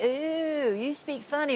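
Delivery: drawn-out Texas drawl; retold Texas twang; imitated Texan. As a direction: lightly mimic a Texan remarking on a newcomer. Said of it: "when you go there for five seconds".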